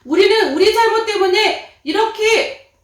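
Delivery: loud, high stern rebuke; the other robber rebuking the mocker, loud and high. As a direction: scold him up high and loud. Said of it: "한 강도는".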